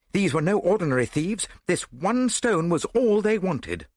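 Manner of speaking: fired up by the clue; excited. A narrator's reading. Hastings excited by the discovery.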